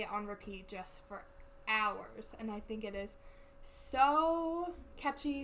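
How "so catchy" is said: excited. Real, not acted. Gushing about the song.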